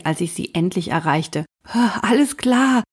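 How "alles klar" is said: panting reply; out of breath. Acted. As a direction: gasp it out, winded. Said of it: "schnaufte ich".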